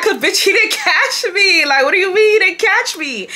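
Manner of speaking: high-pitched, laughing through the words; laughing while retelling. Retold or imitated. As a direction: crack up laughing mid-quote. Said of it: "I'm laughing".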